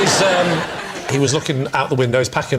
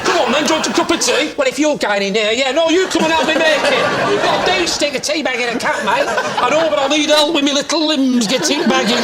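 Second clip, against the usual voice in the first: much higher, hammed-up northern blokes; mock northern chat; 10 semitones higher than usual. Acting out a tea chat with the electrician, mock northern voices. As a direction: act out a daft northern exchange.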